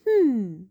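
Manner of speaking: loud, rising hmm; thoughtful hum. A narrator's reading. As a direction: hum while thinking it over. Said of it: "I hummed".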